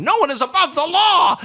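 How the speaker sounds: shrieked high and loud, a frenzied chant; mock scream. Mocking a street mob screaming the slogan. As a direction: yell it like a fanatic mob in the street. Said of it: "and scream".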